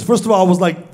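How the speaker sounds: usual speaking voice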